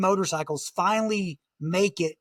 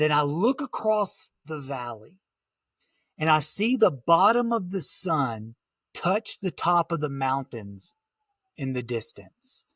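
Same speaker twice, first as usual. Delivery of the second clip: ordinary delivery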